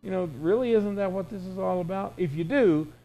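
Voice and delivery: ordinary delivery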